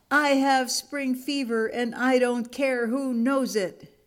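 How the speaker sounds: high storybook shout, volume held back; mild shouting. A narrator's reading. Happy monkey lets everyone know, lightly shouting. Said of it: "and shouted".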